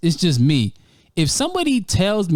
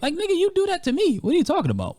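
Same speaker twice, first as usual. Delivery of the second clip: high indignant retort; high outraged comeback; 13 semitones higher, faster than usual. Retold or imitated. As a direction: pitch it up in outrage.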